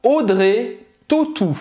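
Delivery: Audrey Tautou said slowly, Tautou as French "totu" with a falling end; French name pronunciation. Shown as a demonstration. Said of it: "O A U do nome dela".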